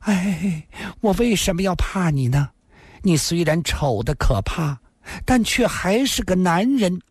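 high, quivering seductive voice; trembling voice. Acted. Lin Xian'er answers Yiku, high and trembling. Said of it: "林仙儿颤声地说道".

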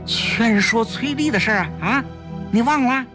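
high-pitched, pressing reminder; worked-up husband prompting his wife, high voice; anxious urging. A narrator's reading. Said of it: "胡维着急起来了".

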